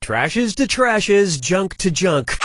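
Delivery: very slow, mock funeral gravity; solemn slow intoning. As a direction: intone it like a eulogy.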